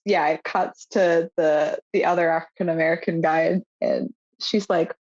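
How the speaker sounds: normal talking voice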